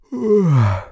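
loud high dragon yawn; sleepy old dragon's roaring yawn; big yawn. Acted. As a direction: stretch it into a huge waking yawn. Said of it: "gähnte der Drache Nobby".

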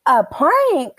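high, loud polite voice; a prim phone-manner voice; mock polite disbelief; social voice. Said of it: "in my social voice".

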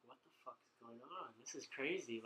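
very soft, stunned aside; dazed murmur. Retold or imitated. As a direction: murmur it to yourself, amazed. Stunned thought while high, voiced in story.

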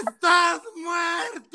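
high, slow hammed-up cry of doom; mock dramatic shout. Retreat leader shouting at teenagers, mock wailing. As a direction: wail it like a preacher scaring kids. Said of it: "nos gritaban esas cosas horribles".

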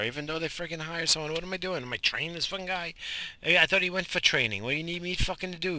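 venting about being sent to train someone; exasperated rant. Real, not acted. Ranting about training new guy.

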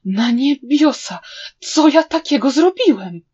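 loud, rising cry of dismay; anguished inner exclamation. A narrator's reading. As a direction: wail in horror at what you've done. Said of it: "oznajmił w duchu". (rozpaczliwy okrzyk przerażenia)

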